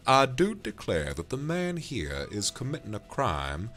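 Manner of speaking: loud, grandly indignant Southern drawl; Southern sheriff accent. Acted. Sheriff denying a crime he is accused of, broad Southern accent. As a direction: proclaim it like an affronted Southern lawman. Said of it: "done the sheriff with that accent".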